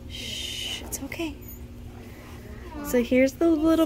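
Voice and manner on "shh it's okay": soft hushing, very quiet. Real, not acted. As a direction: shush it gently to calm. Hushing the scared animal.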